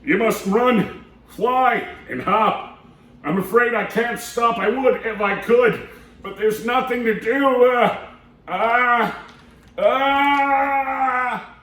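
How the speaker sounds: put-on lively read-aloud voice for kids; storybook reading. A narrator's reading. Storytime host reading a picture book to kids, lively and animated. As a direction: read it to the children with big character voices.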